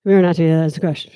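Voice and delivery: fast, low mumble into the page; mumbling demo. Shown as a demonstration. Coach showing students mumbling. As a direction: swallow the words as a bad example. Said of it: "mumbling, such as".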